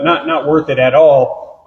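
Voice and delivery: ordinary talk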